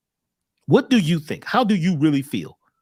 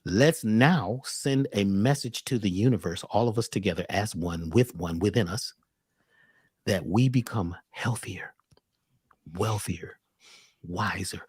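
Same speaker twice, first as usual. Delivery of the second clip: guided meditation; 9 semitones lower, 3 dB quieter than usual. Acted. Host leads listeners in a low, soft guided meditation. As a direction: guide them into calm.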